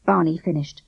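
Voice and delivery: usual speaking voice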